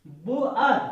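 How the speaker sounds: "बु आर" in two parts, "boo" then "ar"; syllable-by-syllable pronunciation. Shown as a demonstration. Teacher showing how boor is read, careful pronunciation demo. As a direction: sound out "बु आर" as two separate syllables. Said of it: "और उसके बाद double ओ का उच्चारण".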